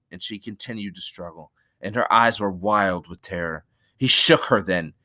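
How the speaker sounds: usual speaking voice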